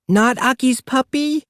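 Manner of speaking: put-on high sad little-kid question; crestfallen toddler. A narrator's reading. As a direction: ask like a toddler about to cry. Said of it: "Aki said".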